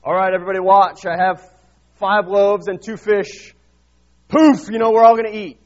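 loud, hammed-up announcer; showman Jesus. Imagined Jesus announcing to the crowd, mock showman shout. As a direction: ham it up like a stage magician. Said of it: "Jesus stood up ... was like".

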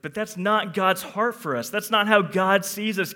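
normal talking voice

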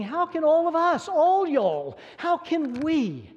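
high, loud appeal punching ALL and WE; emphatic we. Retold or imitated. Preacher voicing Paul to the whole congregation, emphatic we. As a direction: ring out "all y'all" and "we" to everyone. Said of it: "So Paul is saying".

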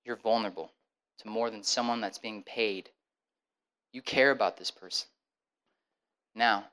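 low, soft, measured counsel; calm him with steady advice; calm counselor. Retold or imitated. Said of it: "And he goes".

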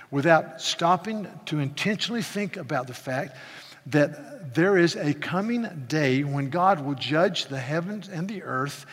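normal talking voice

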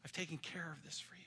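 soft whisper of comfort; whispered reassurance. Acted. Jesus promising believers he took care of it, whispered. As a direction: breathe it like a secret promise.

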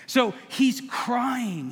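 drawn out slowly, each word leaned on; slow emphatic line. Real, not acted. Pastor stressing to the congregation that Nehemiah wept, slow and emphatic. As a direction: stretch it out to land the point.

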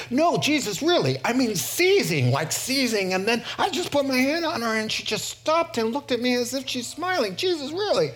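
high, insistent amazement; excited disciple. Acted. Disciple telling Jesus of a healed baby, excited. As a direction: gush like a disciple desperate to be believed.

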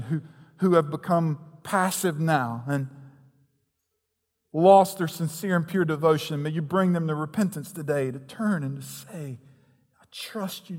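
earnest pastoral prayer. Acted. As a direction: pray sincerely over the congregation. Leads prayer.

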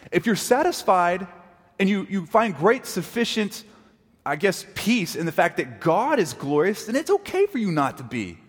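ordinary talk, nothing marked

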